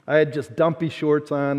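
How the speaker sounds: ordinary talk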